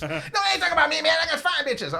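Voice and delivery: very high protesting hustler. Retold or imitated. Little hustler correcting the narrator's misunderstanding.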